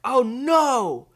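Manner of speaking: loud, high cry of dread; mock dismay. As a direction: cry out in hammed-up alarm. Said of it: "And we're like".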